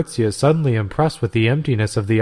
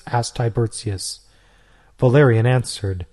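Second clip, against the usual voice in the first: ordinary delivery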